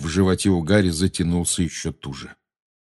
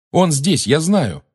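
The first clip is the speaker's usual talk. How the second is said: desperate insistence; 8 semitones higher, 4 dB louder, slower than usual. A narrator's reading.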